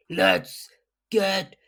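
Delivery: loud, high, drawn-out shout; Krill's battle cry. Acted. Player as Krill running in shouting, long loud cry. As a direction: bellow it and stretch the last word out for seconds. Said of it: "Krill voice".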